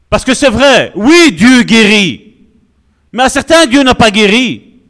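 rising sermon climax; full-force preaching. Acted. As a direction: preach it out to the whole church. Preaching to the congregation.

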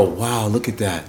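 low, soft, slow awe; hushed admiration. Acted. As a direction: murmur it like an awed spectator. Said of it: "They just shut up".

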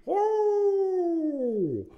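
high sustained hooting call; owl hoot impression. Shown as a demonstration. Host mimics an owl hoot for listeners. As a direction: imitate the barred owl you just heard. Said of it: "That's my impression".

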